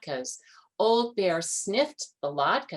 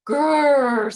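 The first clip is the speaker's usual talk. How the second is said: high loud grrr; happy bear growl; 8 semitones higher, 11 dB louder than usual. A narrator's reading. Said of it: "said Old Bear".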